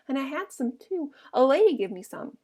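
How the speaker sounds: pleased, pitch lifting; mildly happy. A narrator's reading. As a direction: share it with simple, happy pride. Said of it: "he cried happily".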